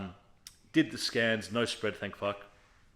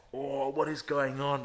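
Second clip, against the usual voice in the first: slow, higher-pitched wail of disbelief, acted; retold distress; 4 semitones higher, slower than usual. Patient outside calling his missus, retold distress. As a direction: draw it out, re-enacting overwhelmed panic.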